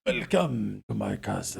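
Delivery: low, looming vampire host; Dracula voice. Acted. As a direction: greet them like a creepy count. Vampire host greeting guests.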